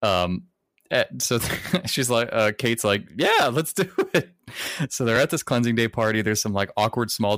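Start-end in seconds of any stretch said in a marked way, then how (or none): 3.2-4.3 mock-eager, higher-pitched quote; eager agreement. Kate agrees to the cleansing day party, mock eager. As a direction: lightly act out someone keen to join in.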